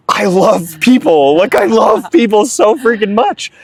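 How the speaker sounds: high, heartfelt outburst; emphatic passion. Real, not acted. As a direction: gush it out, drawing out the first words. Declares his love for people emphatically.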